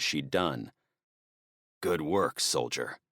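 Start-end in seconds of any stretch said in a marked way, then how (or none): 1.8-3.0 weak, softer croak, fading out. Acted. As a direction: croak it out, badly wounded. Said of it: "Smith croaked".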